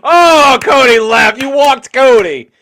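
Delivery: loud, high wail of dismay; mock outcry. Teasing the cohost that Cody walked out, loud mock dismay. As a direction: cry out in hammed-up disbelief.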